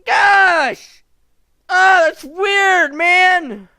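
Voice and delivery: high, loud rattled exclamations; shocked outburst. Real, not acted. Gamer reacting aloud to the creepy scene, shocked shouting. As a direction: still shaken, yell out the shock.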